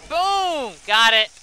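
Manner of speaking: high, loud exclamation; triumphant throw. Real, not acted. Player landing the splash potion, loud triumphant shout.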